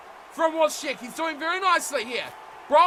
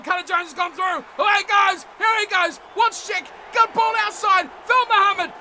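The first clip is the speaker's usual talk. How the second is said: excited play-by-play; 6 semitones higher, 7 dB louder than usual. Real, not acted. Live play-by-play of a break.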